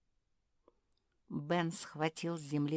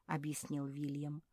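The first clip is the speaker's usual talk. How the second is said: ordinary delivery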